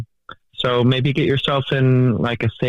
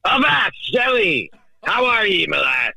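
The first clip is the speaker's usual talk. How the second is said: put-on high, hammy pirate accent, arr matey style; a pirate captain voice hamming it up on a voicemail; 4 semitones higher than usual. Caller woos Shelly on voicemail in his pirate accent, hammed up high. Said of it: "I know you've never heard this voice before".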